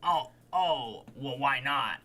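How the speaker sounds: mimicking Liam. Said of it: "And he's like".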